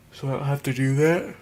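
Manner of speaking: talking through a yawn. Real, not acted. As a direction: yawn while saying it. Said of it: "I'm yawning 'cause I'm really tired".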